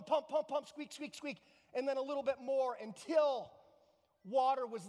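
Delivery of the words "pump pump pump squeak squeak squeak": raised, insistent rhythm of a pump handle; harder pumping. Acted. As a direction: pump away with more effort. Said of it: "he kept going harder".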